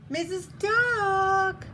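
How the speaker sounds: high, drawn-out holler; calling out. A narrator's reading. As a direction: call out long and loud from a distance. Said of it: "on her doorstep calling".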